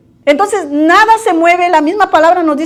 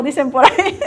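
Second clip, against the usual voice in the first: laughing; 3 semitones higher, 5 dB quieter than usual. Real, not acted. Laughing after joke.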